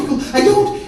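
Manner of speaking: high-pitched, fretful voice; panicky wife. Retold or imitated. Wife afraid to jump into the car, high and panicky. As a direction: imitate her losing her nerve.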